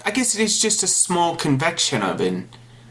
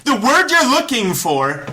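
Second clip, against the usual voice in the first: smug; 10 semitones higher, 4 dB louder than usual. Real, not acted. Jokingly claims the compliment.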